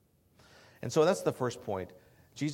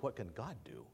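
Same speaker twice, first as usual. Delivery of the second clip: quiet; 2 semitones lower, 9 dB quieter than usual. Shown as a demonstration. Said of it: "The question is".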